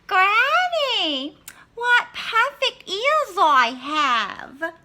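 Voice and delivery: put-on high, loud, slow vain girl; Little Red. Little Red admiring herself to Granny, high and slow.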